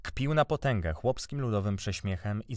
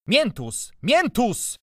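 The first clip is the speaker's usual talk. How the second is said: high, loud calls after someone; calling out; 13 semitones higher, 8 dB louder, much slower than usual. A narrator's reading. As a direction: call after him, louder and higher. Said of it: "wołałem bez skutku".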